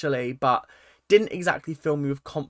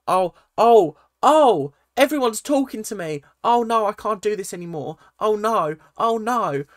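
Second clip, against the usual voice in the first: hammed-up rising distress; higher, wailing mock panic; mock overwhelmed; 6 semitones higher, 6 dB louder than usual. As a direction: mimic a psychic swamped by voices. Acting out overwhelmed psychic wife.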